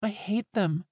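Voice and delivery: put-on high-pitched boyish voice; a child's high-voiced confession of hate; young boy. A narrator's reading. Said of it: "shrinking back into boyhood".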